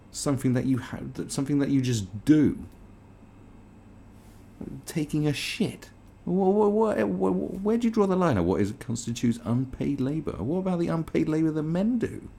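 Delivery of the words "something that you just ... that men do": usual speaking voice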